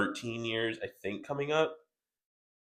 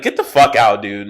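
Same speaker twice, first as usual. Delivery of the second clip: louder mock yell at the coworker; retold yell; 8 dB louder than usual. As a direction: throw him out, louder. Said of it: "I was like".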